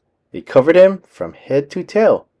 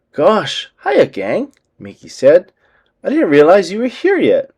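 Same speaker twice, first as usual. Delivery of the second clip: Mickey voice; 6 semitones higher than usual. A narrator's reading. Mickey's voice in story.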